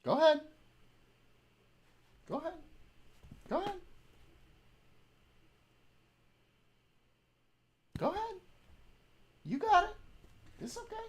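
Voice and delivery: high, sing-song go-ahead to the dog; high pet encouragement. Real, not acted. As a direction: cheer the puppy on, high.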